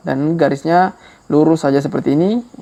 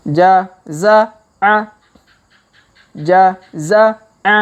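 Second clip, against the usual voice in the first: Jaza'a drawn out slowly, every syllable lengthened; slow reading; 3 semitones higher, 3 dB louder, much slower than usual. Shown as a demonstration. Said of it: "Kita baca pelan-pelan".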